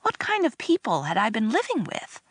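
usual speaking voice

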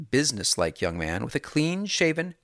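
ordinary delivery

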